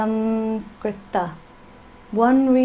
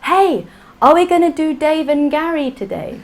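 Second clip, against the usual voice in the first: higher, laughing English student voice; English student accent; 5 semitones higher, 4 dB louder than usual. Retold or imitated. Teacher imitating her English students, laughing English accent. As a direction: play the breezy English student mangling the word. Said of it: "they're so English, they're like".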